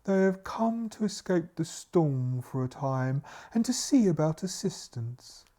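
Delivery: slow, formal butler character; butler's voice. Acted. Butler explains the guests to the maid, slow character voice. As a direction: draw it out as the butler. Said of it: "explains the butler".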